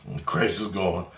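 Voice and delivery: ordinary delivery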